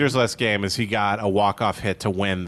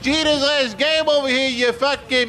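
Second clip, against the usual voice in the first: New York heckler; 13 semitones higher, slower than usual. Pretend. Stadium fan yelling back.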